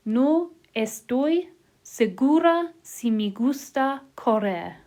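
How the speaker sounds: slow, word by word, each word separated; over enunciating. Shown as a demonstration. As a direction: break "No estoy segura si me gusta correr." into single words, spaced apart. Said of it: "break it down so you're doing each word at a time".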